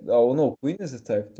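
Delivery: normal talking voice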